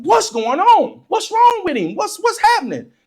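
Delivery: put-on high-pitched kid firing off questions; anxious questioning. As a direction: imitate a confused child demanding answers. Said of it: "I'm asking".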